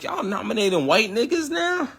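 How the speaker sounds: mock-incredulous high-pitched question; high, rising retort of disbelief. Incredulous retort about award nominations.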